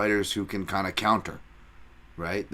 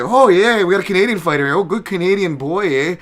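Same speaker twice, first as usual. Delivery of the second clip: much higher, much louder hammed-up Canadian accent; mock Canadian fan; 10 semitones higher, 11 dB louder than usual. Mocking Canadian fans hyping a local fighter, loud high hammed-up Canadian accent. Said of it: "All the Canadian people will be like".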